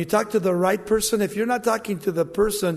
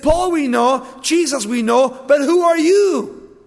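loud, high taunt rising to the last word; challenging demons; 7 semitones higher, 7 dB louder than usual. Acted. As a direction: call them out as a demon, loudly. Acted demons challenging exorcists.

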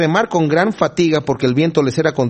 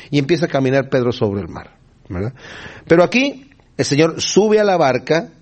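usual speaking voice

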